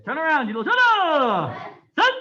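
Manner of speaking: high, loud calls with long falling tails; shouted drill commands. Real, not acted. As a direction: bellow each command, stretching the last vowel. Instructor calls out turn and count.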